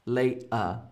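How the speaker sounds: ordinary talk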